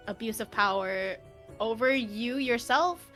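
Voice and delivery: ordinary delivery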